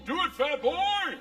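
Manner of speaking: crowd egging on. Retold or imitated. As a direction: holler it slowly like a goading crowd. Crowd of women egging him on.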